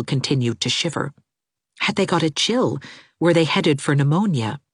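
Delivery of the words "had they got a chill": plain reading voice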